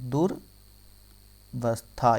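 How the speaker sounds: दुर्वस्था very slowly, broken after दुर; syllable by syllable. Shown as a demonstration. Teacher dictating the correct word to students, syllable by syllable. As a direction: spell out दुर्वस्था in long separate parts. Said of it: "याद रखना".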